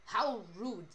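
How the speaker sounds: mock-offended Russian accent. Streamer scolds friend for doubting the accent, put-on Russian accent. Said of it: "You're not Russian either".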